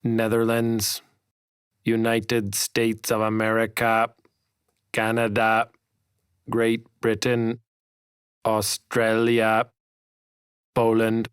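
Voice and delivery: slow, evenly spaced announcer calling the nations; robotic umpire voice. Retold or imitated. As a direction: read the list like a machine at the start line. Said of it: "The umpire's robotic voice rang out".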